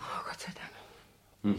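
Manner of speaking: breathless whispered relief, very slow; relieved whisper. Acted. A woman whispers in relief that no accident was reported.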